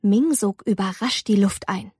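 plain reading voice

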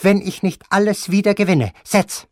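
high, loud, imperious grandmother; imperious grandmother sending him off, high and loud; angry old grandmother. Acted. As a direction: snap at him like a furious old lady. Grandmother voiced in the story.

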